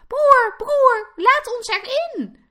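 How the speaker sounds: high, loud squealed begging; pleading. A narrator's reading. Piglets at their oldest brother's door, pleading loudly. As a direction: beg shrilly like terrified little piglets. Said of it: "smeekten ze".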